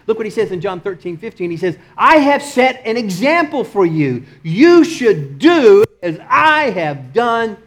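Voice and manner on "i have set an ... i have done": high, loud, slow scripture declaration; preaching at full force. Acted. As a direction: proclaim the verse word by word.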